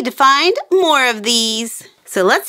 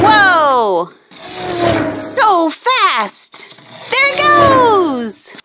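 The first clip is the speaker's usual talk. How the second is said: excited cheer; 6 semitones higher, much slower than usual. Real, not acted. Excited toy race.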